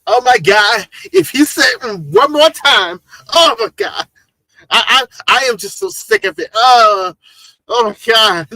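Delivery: put-on high, loud Valley Girl exasperation; exasperated Ari impression. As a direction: wail it like a fed-up Valley Girl. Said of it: "That's Ari".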